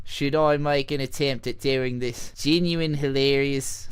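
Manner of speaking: badly faked Aussie twang; exaggerated, clumsy Australian vowels; bad Australian accent. Shown as a demonstration. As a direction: attempt a hammed-up Australian accent. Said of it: "Should I do this in a bad Australian accent".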